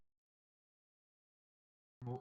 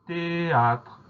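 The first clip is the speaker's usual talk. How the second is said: drawn-out dictation; 6 semitones higher, 3 dB louder than usual. Shown as a demonstration. Teacher announces the word to spell.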